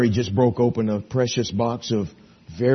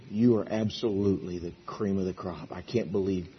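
soft, gentle thanks; tender wife; 5 semitones lower, 8 dB quieter than usual. Pretend. A wife telling her husband she's grateful, soft and tender. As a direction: say it softly like you mean every word. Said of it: "If a wife would say to her husband".

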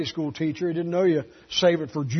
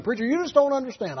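higher, fast whiny quote; imitating people who say he doesn't understand; mock complaint; 7 semitones higher, faster than usual. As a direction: mimic the members brushing him off.

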